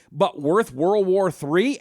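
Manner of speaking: slow, loud, pointed question; slow emphatic disbelief; incredulous emphasis. Real, not acted. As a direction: lean hard on each word in disbelief. Outraged rhetorical question.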